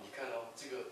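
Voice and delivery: ordinary talk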